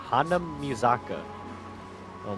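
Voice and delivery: ordinary delivery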